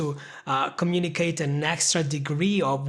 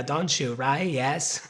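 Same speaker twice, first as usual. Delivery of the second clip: normal talking voice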